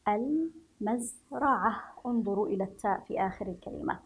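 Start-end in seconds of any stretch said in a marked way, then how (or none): 0.1-1.8 المزرعة in slow pieces, al, maz, ra'ah; syllable-by-syllable reading. Shown as a demonstration. Teacher repeats for pupils, المزرعة slowly in parts. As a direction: draw out each part of المزرعة. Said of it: "وتستطيعون القراءة".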